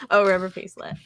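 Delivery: ordinary talk, nothing marked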